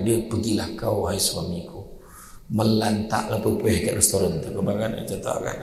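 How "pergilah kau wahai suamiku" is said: mock sweet wife. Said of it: "orang perempuan donga tu".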